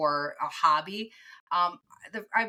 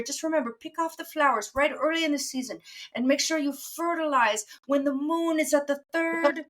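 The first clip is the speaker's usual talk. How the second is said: higher, lilting Irish farmer voice; Irish accent; 8 semitones higher than usual. Retold or imitated. Irish blueberry farmer advising the speaker, in an Irish accent. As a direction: play an Irish blueberry farmer giving friendly advice. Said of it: "I gave this an Irish accent".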